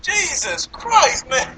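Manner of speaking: drawn-out high exasperated groan. Real, not acted. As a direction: stretch it out in disbelief. Exasperated outburst.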